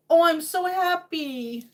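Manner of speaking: usual speaking voice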